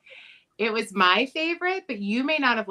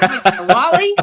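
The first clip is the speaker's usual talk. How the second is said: laughing echo; 3 semitones higher, 11 dB louder, slower than usual. Real, not acted. Echoing laughing.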